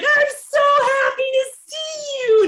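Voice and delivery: high, loud, overjoyed greeting; relieved gushing. Retold or imitated. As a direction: gush at the doctor with relief.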